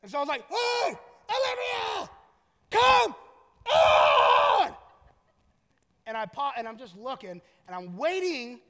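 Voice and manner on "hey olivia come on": very high, loud name call; hollering dad. Retold or imitated. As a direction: bellow the name as through cupped hands. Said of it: "two hands over the mouth".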